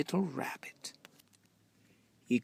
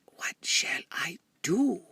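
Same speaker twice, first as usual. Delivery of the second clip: whisper; 6 semitones higher than usual. A narrator's reading. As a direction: breathe it with no voice. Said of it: "he whispered to a squirrel".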